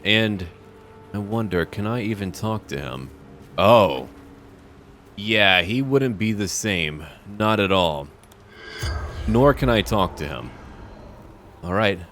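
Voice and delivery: usual speaking voice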